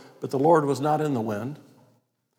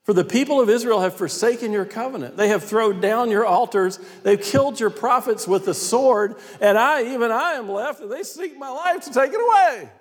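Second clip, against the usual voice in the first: aggrieved Elijah; 8 semitones higher than usual. Acted. Prophet pleading his case to God, aggrieved. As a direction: complain to God at a high pitch.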